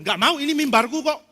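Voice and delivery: put-on high, loud, childish refusal; stubborn refusal. Liturgist refuses to leave the pulpit, loud and petulant.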